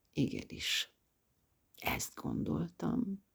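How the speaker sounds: slow, soft whispered admission; confessing her thought with disgust, whispered. A narrator's reading. Self-reproach.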